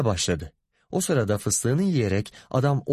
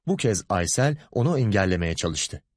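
ordinary delivery

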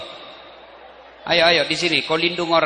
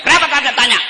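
higher, louder sharp retort; firm wife; 8 semitones higher, 6 dB louder than usual. Acted. Wife scolding husband on the phone, sharp and loud. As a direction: challenge him back. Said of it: "Coba kau tegas".

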